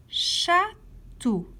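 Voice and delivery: "Château" split in two with a clear pause; syllable by syllable. Shown as a demonstration. Teacher letting pupils locate a sound, "Château" syllable by syllable. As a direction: stretch out "Château", pausing between its two syllables. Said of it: "Colorie la case où tu entends to".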